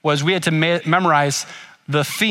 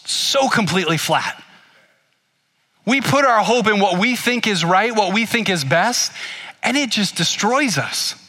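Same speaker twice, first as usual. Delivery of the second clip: preaching; 4 semitones higher than usual. Acted. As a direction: drive the point home to the congregation. Preaching application of story.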